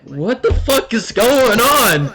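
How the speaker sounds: high, loud, drawn-out yell; hammed-up freak-out, half laughing; panicked outburst. Freaking out as the room goes dark, panicked yell.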